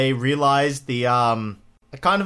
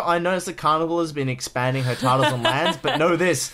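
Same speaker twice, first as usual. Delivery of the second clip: ordinary talk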